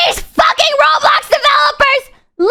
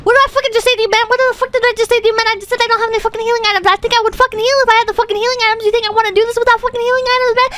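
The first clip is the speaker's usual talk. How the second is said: fast furious rant; 3 semitones lower, much faster than usual. Real, not acted. Streamer berating his teammate over the healing items, fast and angry. Rants at teammate about heals.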